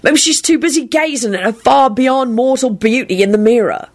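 high-pitched aggravated mockery; mock the beloved with exasperation. A narrator's reading. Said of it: "in an aggravated tone".

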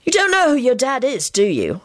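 put-on high-starting, matter-of-fact boy's question; blunt. A narrator's reading. Alex asks Timothy about his dad, blunt. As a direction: put it bluntly as a child would. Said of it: "Alex asked him bluntly".

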